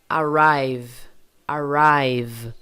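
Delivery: ARRIVE loud, buzzing right through the final V; fully voiced V. Shown as a demonstration. Teacher with hand on throat, voiced V. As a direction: keep the throat vibrating to the end of ARRIVE. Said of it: "Percebe que vibra tudo".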